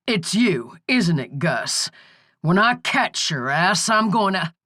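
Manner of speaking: ordinary delivery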